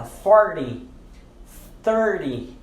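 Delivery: "forty", "thirty" with D in place of T. Shown as a demonstration. Teacher models numerals, "forty" and "thirty" with a D. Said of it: "Você vai pronunciar a letra D".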